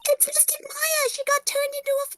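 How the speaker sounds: put-on high-pitched excitable girl voice; young girl character. Player voicing the young girl reading the clue, high. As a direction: play the girl, high and eager.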